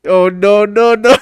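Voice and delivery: imitating a viral "oh no"; meme imitation. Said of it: "I forgot all about that meme".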